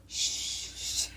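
long unvoiced shushing hiss. Showing friend how she would silence it, long shush. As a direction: draw out a soft shush. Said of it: "I go".

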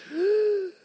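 long, high sucked-in breath; huge imitated gasp. Sister winding up to scream, huge mimicked gasp. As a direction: gasp in like a child before screaming. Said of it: "and then the biggest breath".

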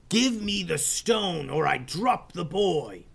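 high pirate villain voice, strained scream; Gruber's screamed demand. Acted. Pirate captain screaming at the ninjas: high villain voice. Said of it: "screamed Hans Gruber".

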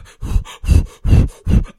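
loud puffing breaths; worked-up sigh. Real, not acted. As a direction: blow out hard breaths to calm down. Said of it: "I'm getting worked up".

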